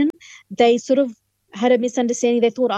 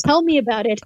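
laughing; 4 semitones higher, faster than usual. Real, not acted. Laughing at host's joke.

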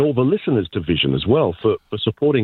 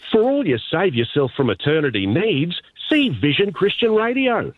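much higher broad country twang; Aussie farmer voice; 7 semitones higher than usual. Retold or imitated. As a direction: play the outback farmer spruiking. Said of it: "your typical Aussie voice".

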